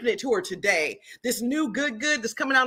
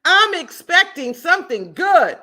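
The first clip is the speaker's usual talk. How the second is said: proclaimed affirmation; 7 semitones higher, 8 dB louder than usual. Shown as a demonstration. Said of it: "here's the affirmation of the day".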